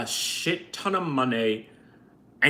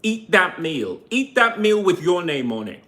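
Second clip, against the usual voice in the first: usual speaking voice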